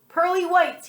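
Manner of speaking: pirate's high, loud curse; cursing. Acted. Disappointed pirate curses the brushed teeth, high and loud. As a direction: spit it out like a pirate swearing. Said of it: "he cursed".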